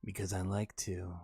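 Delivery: low, slow, hammed-up pickup line; mock sultry request; sexy voice. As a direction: purr the cheesy line. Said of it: "Can you say in sexy voice?".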